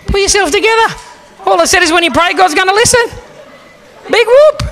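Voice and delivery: very high squeaky protest; hammed-up falsetto exasperation; mock exasperation. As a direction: squeal it in comic disbelief. His exasperated thought at crying woman.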